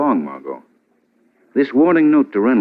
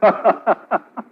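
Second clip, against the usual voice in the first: a short higher laugh; amused laugh; 10 semitones higher than usual. Acted. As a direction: laugh at the cabbie's confession. Amused by Shrevey's qualms.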